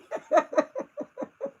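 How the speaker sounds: high-pitched burst of laughter. Real, not acted. Cracking up at the Miss Piggy story, high laugh. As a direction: let a squeaky laugh escape.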